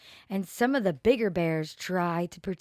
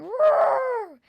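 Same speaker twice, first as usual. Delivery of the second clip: much higher, much louder full roar; big lion roar; 8 dB louder than usual. A narrator's reading. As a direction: let the lion really bellow. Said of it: "Leonard started roaring".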